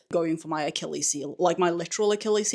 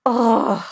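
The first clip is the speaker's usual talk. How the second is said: dramatic sigh; 5 semitones higher, 8 dB louder than usual. Pretend. Theatrical groan of despair.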